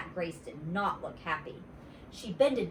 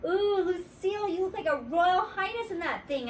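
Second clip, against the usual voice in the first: put-on admiring little girl; 10 semitones higher than usual. Junie B admiring.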